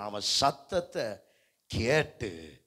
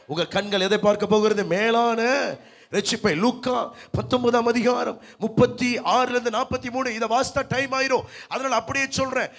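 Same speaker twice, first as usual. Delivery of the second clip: high, loud pulpit voice; full-force preaching; 8 semitones higher, 6 dB louder than usual. Acted. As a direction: drive the Bible story towards the congregation, high and insistent. (உரத்த குரலில் பிரசங்கம்)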